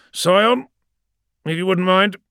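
captain summoning his champion, voice lifted; commanding call. Acted. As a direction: call out the order, loud and high. Captain gives champion an order.

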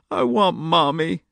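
put-on high, slow, tearful child; sobbed. A narrator's reading. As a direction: whimper for her mother. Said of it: "she sobbed".

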